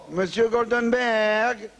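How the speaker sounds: drawn-out greeting. Acted. Client greeting banker slowly.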